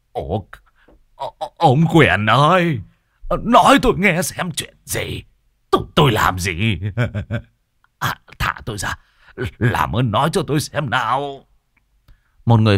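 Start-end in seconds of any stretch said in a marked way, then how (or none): 0.1-11.4 higher, trembling begging; whimpering plea. A narrator's reading. Hồng whimpers to Quyền Thọt begging for answers. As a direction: whine out a desperate appeal. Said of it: "Ông Hồng mếu máo gọi".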